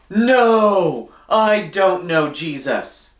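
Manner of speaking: Peter blurts out his denial, slowly; Peter denying. A narrator's reading. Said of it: "What do you think Peter said?".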